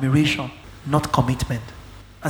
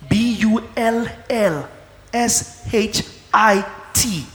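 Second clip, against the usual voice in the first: slow, deliberate letter by letter; emphatic spelling out; 3 semitones higher, slower than usual. Real, not acted. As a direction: spell it out with a pause between letters. Spells out the marked word.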